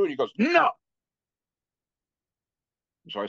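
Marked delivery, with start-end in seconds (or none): 0.4-0.7 very high, louder cut-off; sharp no. Retold or imitated. Lama halting the student's tearful rush, sharp and high. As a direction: stop him dead with one word.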